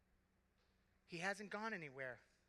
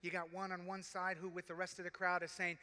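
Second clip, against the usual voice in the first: normal talking voice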